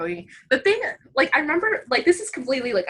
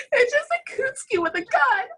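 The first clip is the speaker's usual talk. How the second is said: laughing; 11 semitones higher than usual. Real, not acted. Describing the absurd scene laughing.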